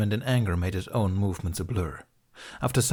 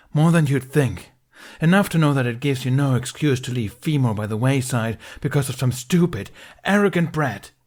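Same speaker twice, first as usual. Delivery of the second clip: louder, higher furious retort; angry shout; 5 semitones higher, 6 dB louder than usual. A narrator's reading. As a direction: shout it at him.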